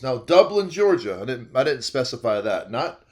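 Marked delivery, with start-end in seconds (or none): none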